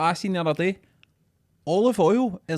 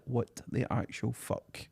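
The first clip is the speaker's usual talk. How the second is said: slow, much softer mock disbelief at the price; 4 semitones lower, 9 dB quieter, much slower than usual. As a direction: drag it out quietly, staring at the price tag. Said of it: "you're like".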